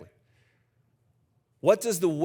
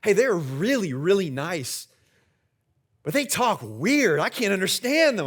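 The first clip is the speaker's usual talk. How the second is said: put-on higher, swooping child-like complaint; imitating puzzled kids; 4 semitones higher, 3 dB louder, slower than usual. His kids leaving a family reunion, imitated puzzlement. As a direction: stretch the key words like a bewildered kid. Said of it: "my kids are both like".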